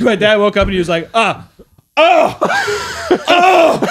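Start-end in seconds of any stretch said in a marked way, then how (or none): 1.1-3.9 high, loud, escalating moans; hammed-up "oh" getting louder; mock disgusted groans. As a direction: imitate dad waking to an awful smell. Dad waking up to the smell.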